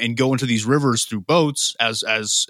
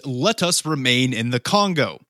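ordinary delivery

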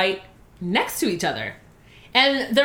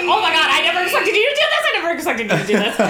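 high, rapid-fire mock panic; panicked; 5 semitones higher, 4 dB louder, much faster than usual. To close friend after her dare, rapid mock panic. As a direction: blurt it out in a hammed-up fluster. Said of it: "So I'm sweating".

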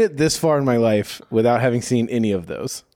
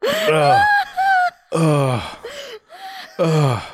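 disgusted groan; much slower than usual. Real, not acted. Recovering from disgust.

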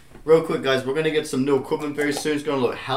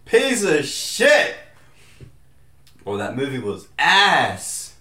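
loud slow dismissal; 2 semitones higher, 7 dB louder, much slower than usual. Real, not acted. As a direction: spit it out slowly. Scathing movie review.

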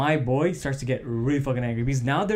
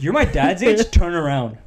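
louder, higher mocking comeback; louder retort; 4 semitones higher, 7 dB louder than usual. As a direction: punch it out at the older guys. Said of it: "the hothead was just like".

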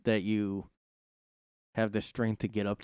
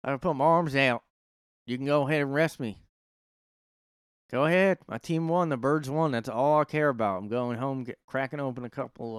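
loud, rowdy Philly sports fan; Philly fan accent; 4 semitones higher, 4 dB louder than usual. Retold or imitated. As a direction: brag like a drunk fan who doesn't care. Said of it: "I have to hear the accent again".

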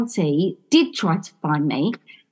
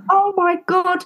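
high astonished exclamation; retold astonishment; 9 semitones higher than usual. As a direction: gasp out your disbelief, pitched high. Retelling her astonished reaction.

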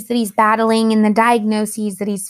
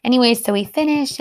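usual speaking voice

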